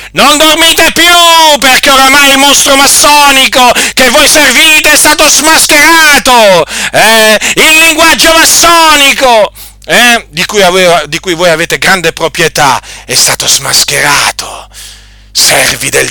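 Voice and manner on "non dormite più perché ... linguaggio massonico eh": high, loud pulpit denunciation; fiery preaching. Acted. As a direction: thunder at them from the pulpit. Preacher denouncing Freemasons.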